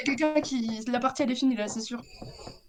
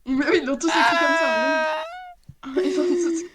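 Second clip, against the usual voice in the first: frustrated shout; 3 semitones higher, 5 dB louder than usual. Real, not acted. Frustrated shout over spelling.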